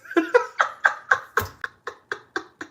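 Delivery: high, breathless unvoiced laughing fit; burst of laughter. Real, not acted. Laughing at herself while confessing she let strangers call free. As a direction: crack up at the memory.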